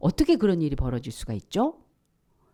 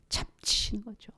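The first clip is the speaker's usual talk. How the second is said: much softer whispered aside; 2 semitones higher, 9 dB quieter than usual. Real, not acted. Preacher whispering how God felt, much softer. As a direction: breathe it out as a secret.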